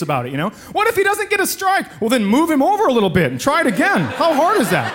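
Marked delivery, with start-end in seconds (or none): 0.7-1.9 high, fretting parent; mildly mocked worried parent; panicky parent. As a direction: mimic a hysterical bowling parent. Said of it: "parents are such lunatics".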